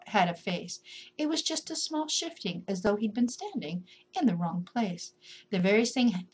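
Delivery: ordinary delivery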